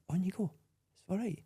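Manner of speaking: soft and high, kindly waving someone on; imitating the stranger's gentle calm; gentle reassurance. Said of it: "he was so gentle".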